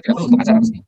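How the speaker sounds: normal talking voice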